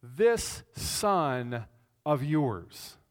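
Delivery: "this son of yours" slow, every word weighted; stressed phrase. Shown as a demonstration. Preacher points out the older son's distancing words, "this son of yours" slow and weighted. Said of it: "identified the younger son as".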